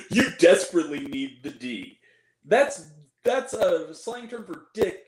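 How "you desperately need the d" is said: mock-insistent movie line; pushier quote. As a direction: lower and louder, leaning on it. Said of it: "She turns to her and says".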